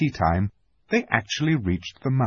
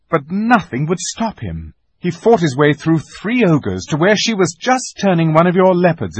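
louder, higher, urgent battle report; Peter, excited earnest account; 6 semitones higher, 6 dB louder than usual. A narrator's reading. Peter recounts Edmund's bravery to Aslan, excited and urgent. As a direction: tell it with breathless admiration.

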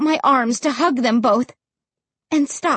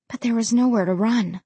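ordinary delivery

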